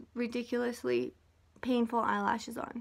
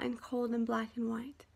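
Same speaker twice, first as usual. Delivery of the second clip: whisper; 8 dB quieter than usual. Real, not acted. Whispered aside about opposites.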